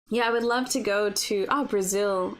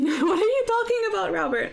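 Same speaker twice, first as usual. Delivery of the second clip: high laughing protest, louder; laughing disbelief; 9 semitones higher, 3 dB louder than usual. Real, not acted. Streamer laughing at viewer Robert's joke, high and incredulous.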